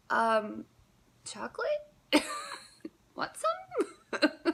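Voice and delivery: squeaky high, laughing innocent offer; sheepish offer. Retold or imitated. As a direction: offer it way up high, playing dumb. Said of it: "It was just kinda like".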